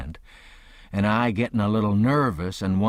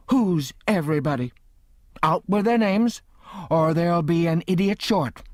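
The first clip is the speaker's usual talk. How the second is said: snapping threat; 8 semitones higher than usual. A narrator's reading. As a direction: threaten him, barely holding in her temper. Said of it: "her eyes snapped".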